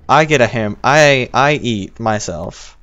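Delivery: ordinary talk, nothing marked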